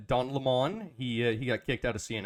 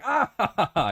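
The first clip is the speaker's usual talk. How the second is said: high, loud mock laugh; a big put-on "ah, ha, ha, ha"; imitated hearty laughter; 8 semitones higher, 6 dB louder than usual. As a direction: act out an audience bursting into laughter. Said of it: "people going".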